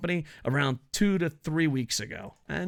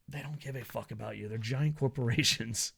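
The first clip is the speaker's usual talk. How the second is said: usual speaking voice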